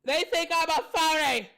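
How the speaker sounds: loud, high outraged shout of the accusation; indignant shout. Real, not acted. Streamer to viewers who doubt him, yelling in outrage. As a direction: yell it out, stung by the insult. Repeats accusation, then yells it.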